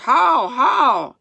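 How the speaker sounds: loud, high repeated holler; yelled command. A narrator's reading. Boy yelling as the sled turns over, loud shout. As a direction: holler urgently to turn the team. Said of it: "Almanzo yelled".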